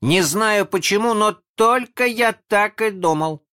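put-on very high, loud child voice; excited boy squealing his words; agitated. A narrator's reading. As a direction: blurt it out, all worked up. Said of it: "сказал Задира взволнованно".

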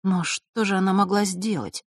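ordinary delivery

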